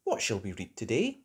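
ordinary delivery, nothing marked

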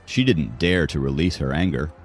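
ordinary delivery, nothing marked

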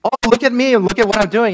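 hammed-up bragging; mock showing off. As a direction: preen like someone craving attention. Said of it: "You're not on the one hand".

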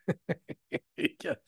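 brief amused chuckle; short laugh. Real, not acted. Teller laughing at own anecdote, short chuckle. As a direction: laugh at the punchline.

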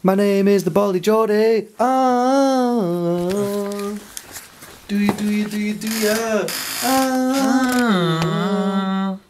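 lightly put-on hyped intro with breathy "ah"s; silly mock YouTuber intro. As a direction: play a goofy channel host. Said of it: "you could go like".

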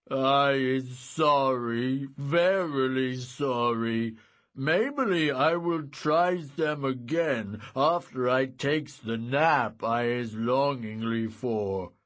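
loud, slow lumbering giant; slow simple-minded giant, glum. Acted. Sleepy giant Shim.

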